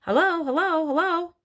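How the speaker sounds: high parrot voice calling out; loud, clear parrot. A narrator's reading. Hidden parrot calls from across the hall, loud and clear. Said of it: "a voice came loud and clear".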